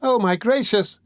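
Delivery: high, theatrical woman's wail; Nancy fakes relief at finding the boy, a high wail; mock distress. A narrator's reading. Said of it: "said the young woman".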